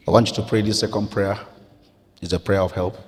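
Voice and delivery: normal talking voice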